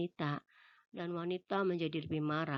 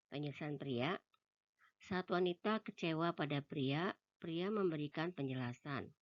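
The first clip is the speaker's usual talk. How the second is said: usual speaking voice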